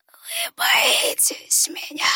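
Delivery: high, slow whisper, a touch of sadness; sad rustling whisper. A narrator's reading. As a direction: rustle it sadly. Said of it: "печально прошелестел незнакомец".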